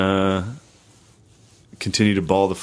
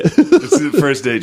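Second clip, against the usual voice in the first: laughing; 8 semitones higher, 6 dB louder, slower than usual. Real, not acted. Laughing at joke.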